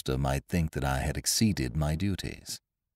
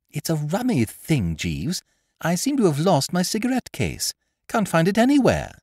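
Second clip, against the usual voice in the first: much higher, louder toff's voice; breezy young master; young gentleman; 10 semitones higher, 5 dB louder than usual. A narrator's reading. Wooster stopping his valet, in a much higher, louder voice.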